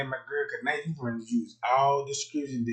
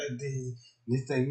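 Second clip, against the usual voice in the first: ordinary talk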